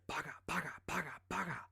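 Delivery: mouth-made gunfire bursts; playful imitated machine-gun fire. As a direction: fire off the shots with your mouth. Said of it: "all of a sudden you hear".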